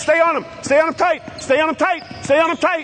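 very high, loud yell across the court; calling from far away. Real, not acted. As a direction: urge the defender on from afar. Coach yelling at defenders.

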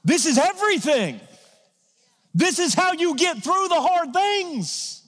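high, slow, stretched emphasis; impassioned preaching. Real, not acted. Pastor telling the church how to get through hard things, impassioned. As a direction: lean into every word.

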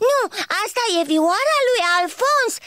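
put-on high, sharp child retort; snapping at her. Fulgușor snapping at ladybird, sharp retort. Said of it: "se răsti la ea".

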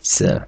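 "с" as a bare s sound; French ce sound. Shown as a demonstration. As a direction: softly model "с" alone. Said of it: "читається як".

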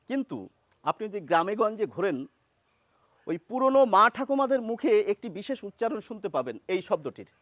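normal talking voice

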